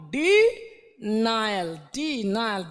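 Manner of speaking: Denial stretched very slowly; slow demo word. Shown as a demonstration. Teacher slowly saying the word for students. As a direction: draw out denial syllable by syllable. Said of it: "teacher stretching word for class".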